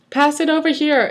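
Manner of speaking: high, playful call; giggly. A narrator's reading. Girl to fellow bullies tossing the lunch, high giggly call. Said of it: "a girl named Beast giggled".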